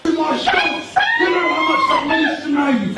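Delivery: high, slow shouted plea through laughter; drawn-out high laughing yell. Acted.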